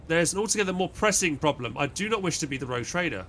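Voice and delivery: normal talking voice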